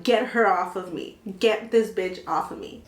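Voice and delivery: ordinary talk, nothing marked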